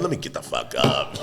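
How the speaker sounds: quiet. Retold or imitated. Low resigned quote.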